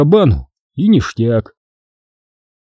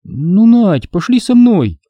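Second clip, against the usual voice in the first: high pleading whine, drawn out; whining; 9 semitones higher, slower than usual. A narrator's reading. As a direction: nag like a scared girl. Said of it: "начала конючить девчонка".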